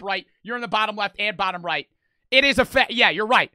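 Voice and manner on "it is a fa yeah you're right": raised, high-pitched agreement; loud concession. Real, not acted. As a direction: concede at full volume. Conceding loudly in an argument.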